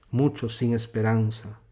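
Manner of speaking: ordinary talk, nothing marked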